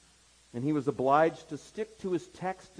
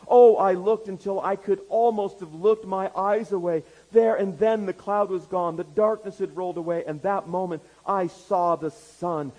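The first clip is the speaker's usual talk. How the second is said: loud, raised, exultant testimony; impassioned preaching; 7 semitones higher, 7 dB louder than usual. A narrator's reading. Preacher retelling a moment of conversion, impassioned. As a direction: preach it with swelling fervour.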